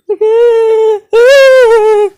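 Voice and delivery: loud, high put-on squeal; exaggerated fake sobbing whine; mock crying wail. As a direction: imitate a long, high-pitched bawl. Imitates embarrassed kid under bleachers.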